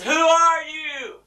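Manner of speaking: high, loud, drawn-out yell; shouted question. Acted. Man on the cliff questioning the voice, shouting. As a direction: holler the question skyward.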